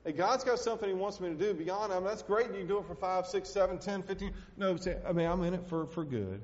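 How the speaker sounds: high, loud revival preaching; preaching at full force. Acted. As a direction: drive it home to the congregation. Preaching climax.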